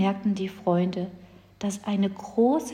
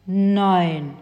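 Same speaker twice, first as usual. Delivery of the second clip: grumbled; 4 dB louder than usual. Acted. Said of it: "brummte der Riese".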